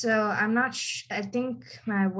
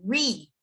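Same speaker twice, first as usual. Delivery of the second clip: "Re" isolated, drawn out as "REE"; emphasized first syllable. Shown as a demonstration. Teacher isolating the noun's stressed beginning, "Re" emphasized. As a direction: lean on "Re" alone. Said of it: "emphasize the beginning".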